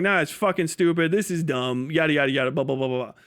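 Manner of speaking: ordinary talk, nothing marked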